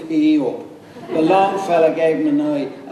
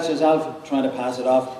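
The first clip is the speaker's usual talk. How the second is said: plain reading voice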